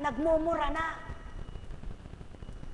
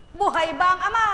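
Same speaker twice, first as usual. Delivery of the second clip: loud refrain; 4 semitones higher, 9 dB louder, faster than usual. Shown as a demonstration. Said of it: "repeated refrain".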